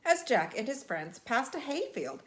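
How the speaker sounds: ordinary delivery, nothing marked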